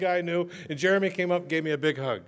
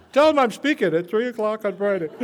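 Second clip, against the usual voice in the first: higher and louder, laughing; laughing joke; 6 semitones higher, 7 dB louder than usual. Real, not acted. Joking to the AA crowd about the sheriff, laughing. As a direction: chuckle through the punchline.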